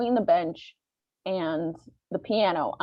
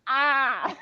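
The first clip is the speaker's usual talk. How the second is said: opossum hiss; 5 semitones higher, 5 dB louder than usual. Retold or imitated. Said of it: "she'll be like".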